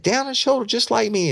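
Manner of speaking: very high-pitched retold answer; excited insistence. Suspect answering detective about hair, excited. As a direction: imitate an eager witness, voice shooting up.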